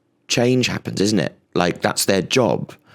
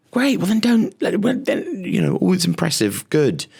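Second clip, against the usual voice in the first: higher mock voice; mock dismissal; 10 semitones higher than usual. Comic brushing off the goat in a higher breezy voice. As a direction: toss it off with a high, breezy mock voice.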